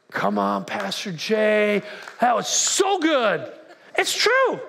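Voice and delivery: hammed-up amen from the pews; mock congregant praise. Congregant cheering on Pastor Jay, exaggerated slow praise.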